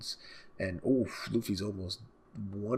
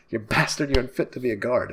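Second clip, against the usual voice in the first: loud, raised, angry yell; angry guard; 4 semitones higher, 9 dB louder than usual. Acted. Furious guard yelling at the prisoner, loud.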